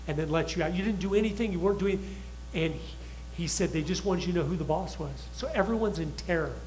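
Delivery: ordinary talk, nothing marked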